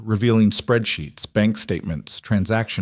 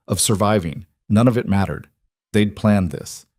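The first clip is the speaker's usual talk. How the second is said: usual speaking voice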